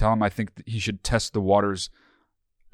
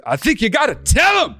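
shouted verdict; 12 semitones higher, 4 dB louder than usual. Shown as a demonstration. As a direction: tell shouted high and loud. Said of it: "Keep going on".

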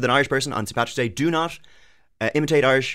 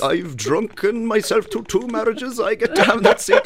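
high, loud mock old man; grumpy old pub regular; 13 semitones higher, 6 dB louder than usual. Imitating an old man telling a newcomer it's his seat. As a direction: play the cranky old boozer defending his seat.

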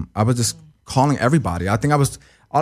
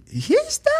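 put-on very high, squeaky girlish voice. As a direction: squeak it out like a frightened girl. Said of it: "Like, like a girl".

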